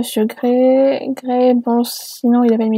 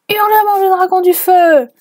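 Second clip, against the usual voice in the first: high, loud anime-style attack shout; hammed-up attack cry; 8 semitones higher, 5 dB louder than usual. Reader hamming up the fanfic's fire dragon attack, loud and high. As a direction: belt out the dragon attack like a shonen hero.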